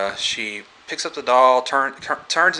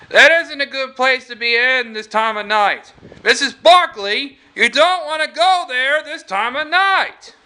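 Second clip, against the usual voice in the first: high, raised-voice warning; urgent mock warning; 13 semitones higher, 4 dB louder than usual. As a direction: play a cop urgently warning her off, pitched way up. Retold officer warning Missus Barkley.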